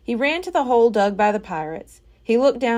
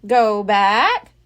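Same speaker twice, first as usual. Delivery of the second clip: squawked; 5 dB louder than usual. A narrator's reading. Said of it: "squawked Polly".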